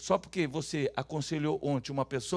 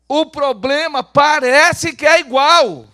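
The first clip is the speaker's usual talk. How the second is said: slow, high, very loud declaration; loud proclamation; 10 semitones higher, 18 dB louder, much slower than usual. Shown as a demonstration. Preacher gives the congregation its line, loud proclamation. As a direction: proclaim it slowly to the whole church. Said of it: "Vamos falar bem alto?".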